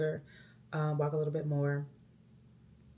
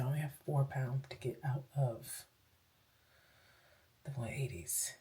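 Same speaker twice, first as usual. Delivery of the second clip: soft whisper; hushed under the breath; 4 dB quieter than usual. Real, not acted. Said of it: "I'm whispering".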